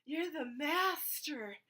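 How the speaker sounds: slow, swooning mock praise; hammed-up worshipping wife voice; mock adoring wife. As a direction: gush over him, dragging it out. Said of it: "his wife is".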